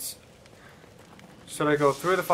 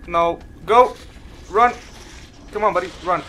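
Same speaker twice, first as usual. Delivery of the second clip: panicked urging; 3 semitones higher, 3 dB louder than usual. Real, not acted. Fleeing an enemy in panic.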